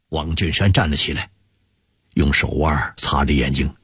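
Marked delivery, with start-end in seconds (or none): none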